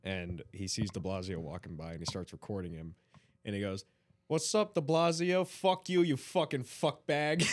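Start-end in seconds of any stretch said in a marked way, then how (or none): 4.3-7.4 loud, high, drawn-out taunting; mocking heckler yell. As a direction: heckle him like a rude New Yorker. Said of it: "He's just ripping on him".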